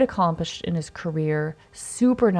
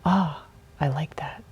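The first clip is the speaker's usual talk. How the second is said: whispered reply; 3 semitones lower, 4 dB quieter than usual. Retold or imitated. Meatball answering the speaker.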